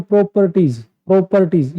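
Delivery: Properties drawn out syllable by syllable; over-stretched word. Shown as a demonstration. Teacher showing how not to stretch a word, properties drawn out. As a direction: drag out each syllable of properties. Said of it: "word को इतना नहीं खींचना है".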